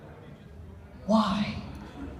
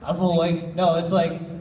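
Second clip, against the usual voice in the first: ordinary delivery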